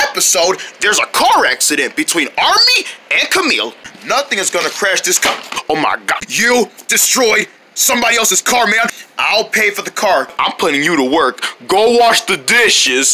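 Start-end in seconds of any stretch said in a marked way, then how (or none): none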